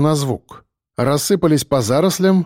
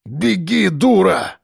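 higher, urgent angry order; angry hiss; 5 semitones higher, slower than usual. A narrator's reading. Biker drags fallen woman onward, angry hiss. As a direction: hiss at her to keep moving. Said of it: "зашипел ворон".

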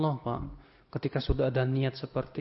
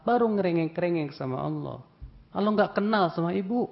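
retold scolding; 5 semitones higher than usual. Preacher retelling how he scolded the woman, reproachful. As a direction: scold her for forgetting God in good times. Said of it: "Saya tanya ke si".

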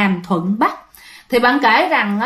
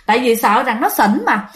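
ordinary delivery, nothing marked

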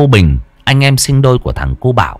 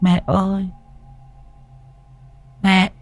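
put-on high-pitched small child calling mother; high, slow, puzzled child's questioning; little boy voice; 9 semitones higher, slower than usual. A narrator's reading. Son calls his mother late at night, high little-boy voice. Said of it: "Thằng bé mới cất tiếng hỏi".

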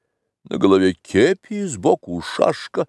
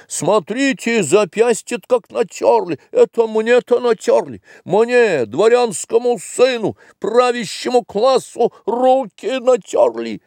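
ожесточённое возмущение; 11 semitones higher than usual. A narrator's reading. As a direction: wail in outrage at the injury. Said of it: "Глумов вдруг ожесточился". (высокий, ожесточённый возмущённый вопль)

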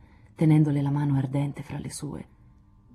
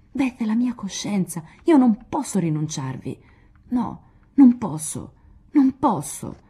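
rising, louder until the voice cracks; breaking into sobs; 3 semitones higher, 6 dB louder than usual. A narrator's reading. Joe telling Lori she cannot lose Beth, breaking down in tears. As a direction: let the last words break into crying. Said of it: "in uno scroscio disperato di lacrime".

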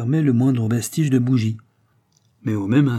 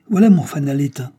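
loud, higher-pitched interruption; abrupt exclamation; 4 semitones higher, 7 dB louder than usual. A narrator's reading. Driver interrupts the courier as his lantern dies, abrupt exclamation. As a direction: cut in suddenly with raised voice. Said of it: "interrompant brusquement le courrier".

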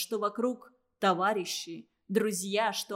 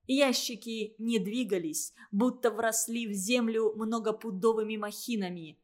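ordinary delivery, nothing marked

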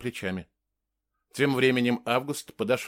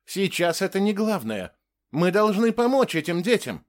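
higher-pitched urgent appeal; pleading; 7 semitones higher than usual. A narrator's reading. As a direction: implore them to focus on helping. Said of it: "взмолился Август". (умоляющий призыв)

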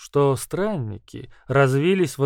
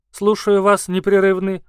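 gurgling, higher and louder chairman; 4 semitones higher, 7 dB louder than usual. Acted. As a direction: bubble the words out wetly, непрерывный gurgled. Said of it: "пробулькал спикер".

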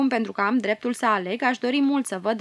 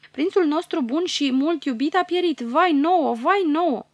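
high, wailing grief; keen for the lost prince; wailing lament; 6 semitones higher, 3 dB louder than usual. Acted. Courtiers wail over Prince Sadni, high and grieving. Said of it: "izbucnesc în tânguiri".

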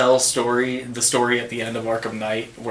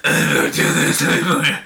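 Batman voice. Retold or imitated. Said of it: "And so he's always like".

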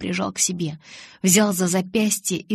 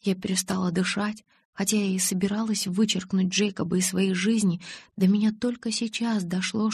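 ordinary delivery, nothing marked

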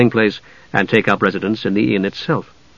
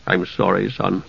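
softer, low mumble; mumbled; 5 semitones lower, 8 dB quieter than usual. A narrator's reading. Caramon mumbling an apology to his son, softer. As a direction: mumble it, lost and unsure. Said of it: "Caramon mumbled".

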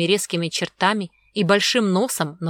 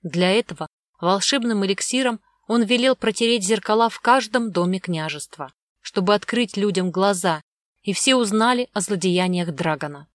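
ordinary delivery, nothing marked

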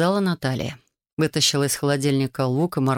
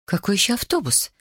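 mildly indignant, raised pitch; 5 semitones higher than usual. A narrator's reading. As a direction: question it with a touch of outrage. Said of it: "возмутилась Мэдди". (с лёгким возмущением)

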